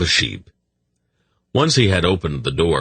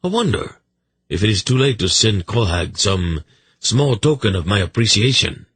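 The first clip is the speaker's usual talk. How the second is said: higher, musing royal voice; mock-thoughtful monarch, pitched up; wry king; 4 semitones higher than usual. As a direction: play a king wondering aloud, tongue in cheek.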